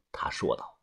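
ordinary delivery

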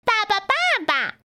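put-on very high baby duckling voice; duckling calling. A narrator's reading. Duckling keeps calling its father. (小鸭子尖声叫爸爸)

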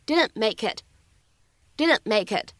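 "didn't make it", t held back, "make it" linked; incomplete plosion and linking. Shown as a demonstration. 老师示范 "didn't make it" 不完全爆破加连读. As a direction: hold the t of "didn't", join "make it". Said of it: "我们说存在不完全爆破".

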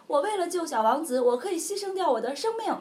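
a faithful dog offers its life; hammed-up noble dog; loyal dog vow. Loyal dog vows sacrifice.

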